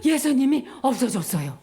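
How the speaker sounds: panting soldier's report; acted breathless, loud first words; out of breath from running. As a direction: gasp out the report after sprinting in. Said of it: "막 뛰어온 거야".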